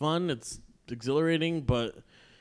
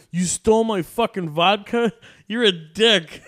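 mock angry text; 8 semitones higher, 9 dB louder than usual. Reading the date's angry text.

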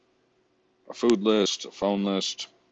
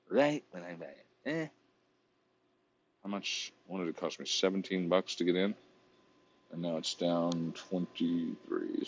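ordinary delivery

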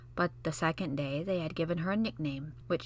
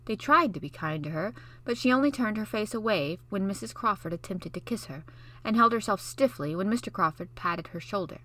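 usual speaking voice

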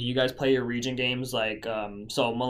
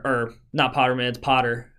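ordinary talk

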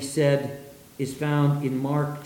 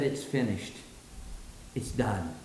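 much lower and softer, fading out; spent, hushed last words on the cross; quiet final words; 7 semitones lower, 9 dB quieter than usual. Acted. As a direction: breathe it out like a dying man.

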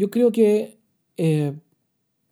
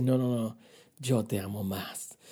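low, soft, slow declaration of love; tender reply; 7 semitones lower, 8 dB quieter, slower than usual. Acted. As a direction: answer gently and slowly, as God reassuring a believer. God answers the believer lovingly. (respuesta tierna, grave, suave y pausada)

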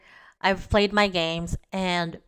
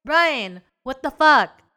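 mock outrage; 5 semitones higher, 5 dB louder, slower than usual. Mock scolding partner.

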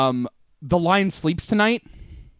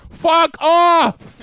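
shouting; 12 semitones higher, 7 dB louder than usual. Pretend. Mock outburst at lads.

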